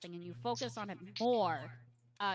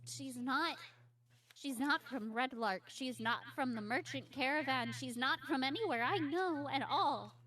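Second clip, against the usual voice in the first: higher voice, repeating and stumbling; frightened child; 6 semitones higher, 3 dB louder, faster than usual. Acted. Lost girl describing her captor, anxious and higher.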